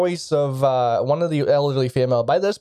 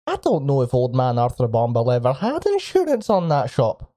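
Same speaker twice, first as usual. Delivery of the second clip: normal talking voice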